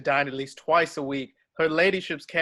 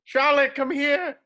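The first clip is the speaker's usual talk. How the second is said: calling out; 8 semitones higher, slower than usual. Acted. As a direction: summon her from the lane at full voice. Collins calls wife from outside.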